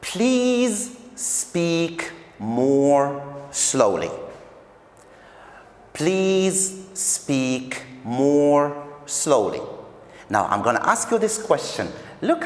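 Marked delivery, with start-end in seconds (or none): 0.0-4.1 very slow, word by word; slow model reading. Shown as a demonstration. Teacher models the example sentence. 5.9-9.6 very slow, word by word; slow model reading. Shown as a demonstration. Teacher repeats the example sentence.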